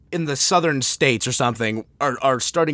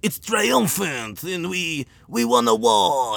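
grandly boasting Frenchman, drawn out; slow, high French-accented caricature of pride; mock French accent; 5 semitones higher, slower than usual. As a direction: brag pompously in a hammed-up French accent. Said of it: "And they're like".

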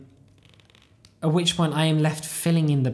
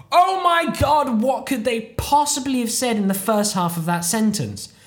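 frantic brain; 9 semitones higher, 5 dB louder than usual. Acted. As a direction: exclaim it like a brain scrambling in overblown desperation. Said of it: "my entire brain scrambles to think".

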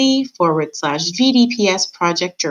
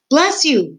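high-pitched exclamation; shouted; 8 semitones higher, 3 dB louder than usual. A narrator's reading. Meg to sneezing Gregory, shouted. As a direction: call it out with pitch raised. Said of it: "shouted Meg".

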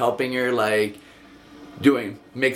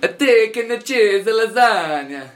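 playful fake Italian accent, pitched up and louder; mock Italian accent; 10 semitones higher, 6 dB louder than usual. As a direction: ham up a fake Italian accent on thick and cheesy lasagna. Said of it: "say that with an Italian accent".

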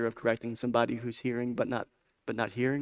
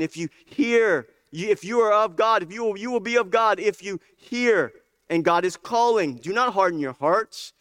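raised, urgent preacher voice with long stressed words; impassioned preaching; 8 semitones higher, 7 dB louder than usual. Real, not acted. Preacher urging people to listen to God's calling, impassioned. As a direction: preach it out, leaning hard on key words.

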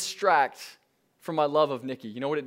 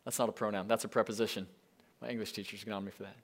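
much softer, fast throwaway aside; quick quiet aside; 8 semitones lower, 9 dB quieter, faster than usual. Real, not acted. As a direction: toss it off under your breath. Quick self-correcting aside.

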